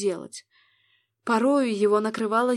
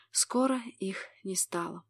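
hushed breathy whisper; sultry narrator; 2 semitones lower, 6 dB quieter than usual. A narrator's reading. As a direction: whisper the line intimately.

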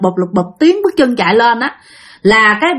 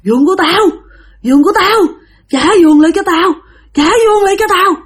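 wailing ghost; 7 semitones higher than usual. Acted. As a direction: howl it like a dead woman who wants her bed. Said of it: "Mà cứ gào".

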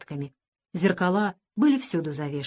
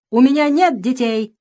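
loud, raised-pitch disowning cry; rough shout; 4 semitones higher, 7 dB louder than usual. A narrator's reading. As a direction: shout it out harshly. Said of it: "крикнула не своим, а каким-то грубым мужским голосом".

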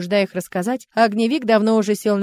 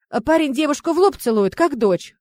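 ordinary delivery

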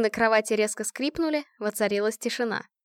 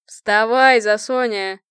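loud, cheery wake-up call; brisk colonel booming down the phone; disgustingly chipper; 8 dB louder, slower than usual. A narrator's reading. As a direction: rouse a sleepyhead with obnoxious cheer. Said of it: "до омерзительного бодрый голос полковника".

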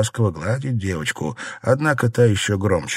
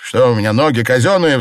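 loud, higher rhetorical question; indignant cry; 4 semitones higher, 7 dB louder than usual. A narrator's reading. Husband refuses another trip, crying out indignantly. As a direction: cry out in exasperation. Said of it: "вскричал Дзялянь".

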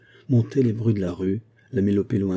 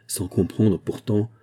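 ordinary delivery, nothing marked

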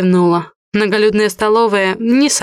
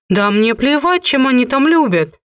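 raised, annoyed outburst; irritated exclamation; 6 semitones higher than usual. A narrator's reading. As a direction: snap it out, fed up. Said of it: "раздражённо воскликнула я".

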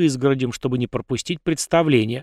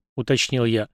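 ordinary delivery

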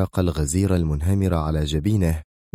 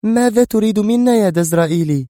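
high, loud challenging question; demanding woman; 4 dB louder than usual. Acted. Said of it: "سألته منى". (امرأة تسأل بصوت عالٍ محتد)

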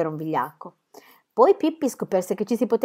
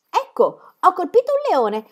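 satisfied exclamation; 10 semitones higher, 4 dB louder than usual. A narrator's reading. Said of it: "esclamava soddisfatta".

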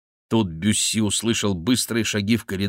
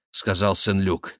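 ordinary delivery

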